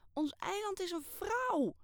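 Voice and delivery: high, loud, slow cry; shouted. Acted. Girl shouting her discovery to the whole island, high and loud. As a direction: yell it out as a revelation. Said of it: "schreeuwde ik".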